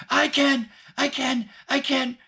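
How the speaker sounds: put-on very high, loud, excited little-girl shout; eager good student. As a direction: shout it like a pupil begging to be picked. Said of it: "like the good student in school".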